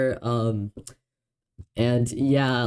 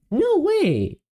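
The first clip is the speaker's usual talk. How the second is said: sudden high yelp of disbelief; surprised. Real, not acted. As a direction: shoot way up in pitch, amazed. Surprised the countdown works fine.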